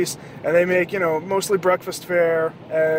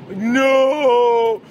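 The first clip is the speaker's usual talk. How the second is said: mock despair; 5 semitones higher, 7 dB louder than usual. Dystopia if Waffle House closes.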